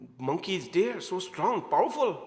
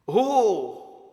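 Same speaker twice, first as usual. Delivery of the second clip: ordinary talk, nothing marked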